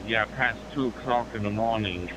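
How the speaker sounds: ordinary talk, nothing marked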